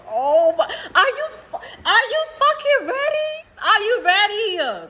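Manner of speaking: high, loud, laughing squeal of excitement; excited squeal. Real, not acted. Squealing at viewers over their blessings. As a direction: shriek it out with delight, drawn out slowly.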